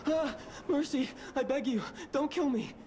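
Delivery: high, frightened begging; pleading for mercy. Acted. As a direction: beg for your life in panic. NPC begs for his life.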